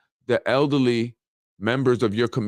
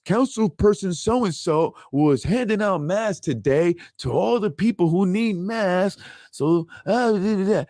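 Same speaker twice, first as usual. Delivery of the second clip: lightly voiced sing-song PR announcer, higher and slower; mock news-report voice; 6 semitones higher, slower than usual. Mocking politicians to his listeners, in a lightly put-on announcer voice. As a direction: sing it like a smug press release.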